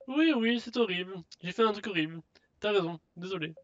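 loud, high "oui, oui" settling down; mock contrition. Threatener answers companion's reproach, mock contrite. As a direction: brush off the scolding with a sham apology.

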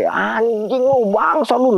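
high, very drawn-out mock curse; retold yelling. Adrian kicks and punches as he yells, stretched mock yell. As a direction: imitate a yelled insult without real volume. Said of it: "Teriak sambil gerakannya nendang sama mukul".

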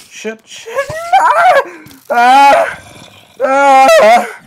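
very high, loud frightened cries; real panic shrieks; panicked screams. Real, not acted. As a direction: scream as mobs attack. Scared in game.

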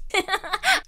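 short giggle; child's laugh. A narrator's reading. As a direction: giggle at the noise. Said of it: "I laughed".

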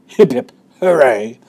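loud hooray; cheering. A narrator's reading. Cheer at Willy Wonka's cleverness, loud hooray. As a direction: cheer it out with force.

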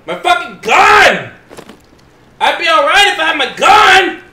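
loud, high, stretched-out outburst; frustrated yell. Real, not acted. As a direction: yell it, wailing on the last word. Yelling about the missing gun.

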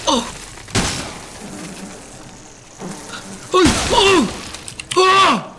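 high, breathless panicky cries; frantic gamer losing it; panicked cries. Real, not acted. Panicked while chased in game.

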